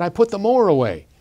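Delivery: put-on boy showing off to his father; eager boy. A narrator's reading. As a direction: announce the chore with pride. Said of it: "the tall boy said".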